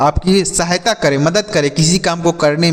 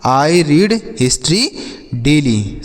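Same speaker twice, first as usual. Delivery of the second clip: slow demo; much slower than usual. Shown as a demonstration. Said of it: "मैं प्रतिदिन इतिहास पढ़ता हूँ".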